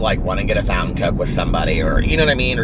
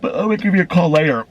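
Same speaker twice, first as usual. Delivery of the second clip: quick, higher mimic of her friend; retelling the friend's reply in a fast, higher mimic; imitating friend; 10 semitones higher, faster than usual. Voicing friend's plans.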